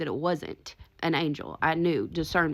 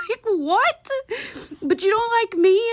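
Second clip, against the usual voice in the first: much higher and louder, baffled; incredulous; 5 dB louder than usual. Retold or imitated. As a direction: squeal out the disbelief. Baffled at hostile entity.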